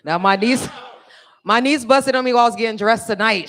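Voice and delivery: ordinary delivery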